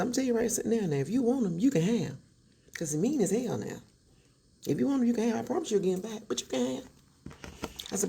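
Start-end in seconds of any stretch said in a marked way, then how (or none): 0.0-6.9 put-on wife warns husband's girlfriend, heated; fed-up warning. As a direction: lay it down firmly, woman to woman. Confronting the mistress.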